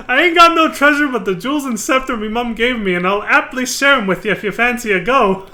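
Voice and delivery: giggly pirate voice, barely holding it together; laughing through it. Acted. Streamer rereading the vulgar pirate line, laughing. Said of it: "there's more".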